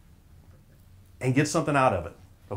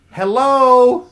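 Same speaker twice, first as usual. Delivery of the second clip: loud, high, rising call; louder prodding hello; 7 semitones higher, 8 dB louder than usual. Real, not acted. Speaker still waiting on the audience, loud questioning hello. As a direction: holler hello up at the end.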